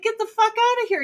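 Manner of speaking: mock crowd. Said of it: "everyone's like".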